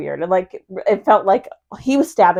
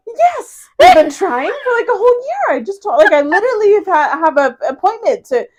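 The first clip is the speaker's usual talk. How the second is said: much higher, louder, tumbling words; excited disbelief; 13 semitones higher, 6 dB louder than usual. Retold or imitated. Telling the doctor she tried for a year, excited and high. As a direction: gush in happy disbelief.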